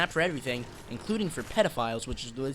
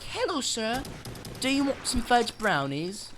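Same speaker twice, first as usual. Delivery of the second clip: high, slow, overly polite salesman voice; a kid doing a silly high sales pitch at the door; odd put-on voice; 6 semitones higher, slower than usual. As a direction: pitch it way up and sell it sweetly. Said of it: "I don't know why I'm speaking like this".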